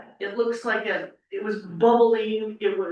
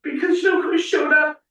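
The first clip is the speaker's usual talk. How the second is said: getting emotional; 6 semitones higher than usual. Real, not acted. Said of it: "I get emotional".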